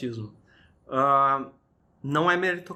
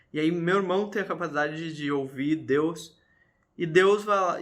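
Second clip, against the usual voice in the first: normal talking voice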